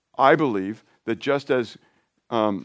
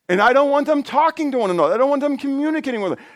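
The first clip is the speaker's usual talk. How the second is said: comic high voice; 13 semitones higher, 3 dB louder, faster than usual. Acted. Joking about chatty squirrels.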